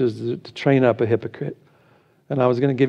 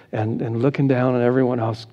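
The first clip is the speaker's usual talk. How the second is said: ordinary delivery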